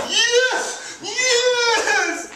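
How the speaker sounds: triumphant, high, loud cries of yes; gleeful shout. Acted. Landlord crowing over his parking lot plan, gleeful shouting. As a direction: crow it out in triumph.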